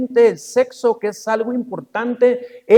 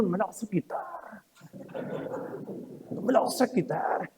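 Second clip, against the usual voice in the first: much softer, hushed little voice; a baby refusing to let go, whispered; baby's stubborn thought; 14 dB quieter than usual. Pretend. Preacher voicing his grandson holding the bottle, hushed.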